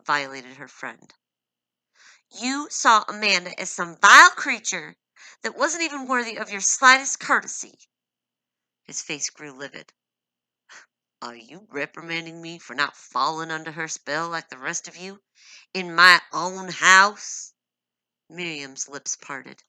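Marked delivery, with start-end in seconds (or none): 2.3-7.8 higher, much louder indignant accusation; angry rebuke. Acted. Wife accusing her husband of contempt for her friend, loud and angry. Miriam rebukes Paul angrily. 11.2-17.5 livid retort. Acted. Livid husband reprimanding his wife under his roof.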